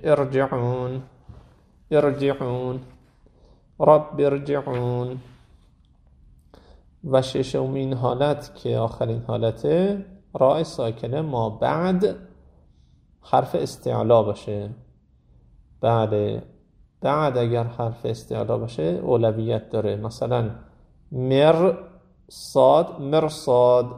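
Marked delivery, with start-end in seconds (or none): 0.0-5.2 ارجعون with a thick ر despite the kasra before it; رب ارجعون, ر pronounced heavy and full. Shown as a demonstration. Teacher repeating the heavy R in ارجعون for the class. 21.1-23.9 مرصاد with a thick, heavy ر before the ص; مر then صاد, the ر full and heavy. Shown as a demonstration. Quran teacher showing the heavy R before a heavy letter. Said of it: "همون غلیظ بگید".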